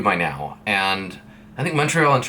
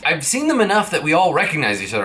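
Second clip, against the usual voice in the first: normal talking voice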